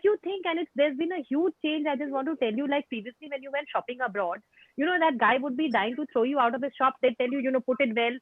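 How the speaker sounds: ordinary delivery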